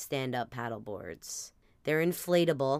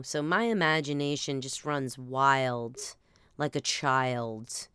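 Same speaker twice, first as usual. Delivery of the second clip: ordinary delivery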